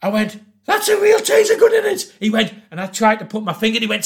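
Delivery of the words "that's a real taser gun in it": much higher, alarmed squeak; shocked squeal. Retold or imitated. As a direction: gasp it out at the sight of the gun. Shocked at the cocked taser.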